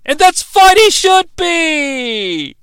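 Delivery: high, loud, stretched-out cheer; mock cheer. Real, not acted. Host cheering on the horny teen, high and drawn out. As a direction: drag it out like a proud cheer. Exclaimed joke.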